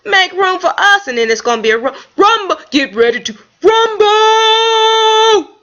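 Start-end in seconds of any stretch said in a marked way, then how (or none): 0.1-1.1 high sassy squirrel trash talk; mock squirrel comeback. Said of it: "And I say". 2.7-5.6 high, loud, slow sung-out announcer call; ring announcer. Pretend. Boxing announcer catchphrase.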